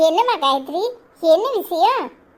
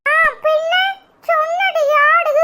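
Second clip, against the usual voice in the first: put-on much higher, chirpy little kid calling out; child voice; 12 semitones higher, slower than usual. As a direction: play an eager small child posing for photos. Child boasts. (உற்சாகமான சின்னப் பிள்ளைக் குரல்)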